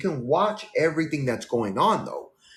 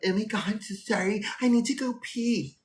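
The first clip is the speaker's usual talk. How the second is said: mock passenger; 8 semitones higher than usual. Passenger voiced mockingly, apologizing.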